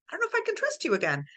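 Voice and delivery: high, fast worried line; anxious rush. Retold or imitated. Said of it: "you're like".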